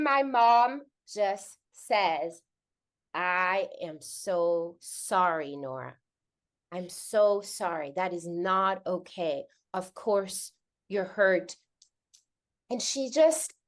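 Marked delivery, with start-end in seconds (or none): 3.1-11.6 slow, lower, tender validation; soft and slow motherly comfort; sincere apology. Retold or imitated. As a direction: apologize gently, giving each word room. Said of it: "she validated and she apologized".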